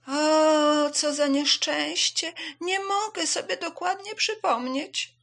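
high, drawn-out lament; loud, high-pitched despair; wailing dismay. Acted. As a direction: wail over your failed memory. Zebra laments forgetting the name.

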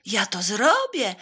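loud drawn-out volunteer; eager shout. A narrator's reading. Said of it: "zawołał brązowy pies".

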